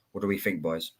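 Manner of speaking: ordinary talk, nothing marked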